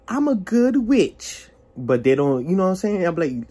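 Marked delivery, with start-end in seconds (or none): 0.1-1.5 high, drawn-out mock boast; mimicking self-declared witch. Mimicking people who claim to be good witches, mocking. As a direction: put it on higher and slowly stretched.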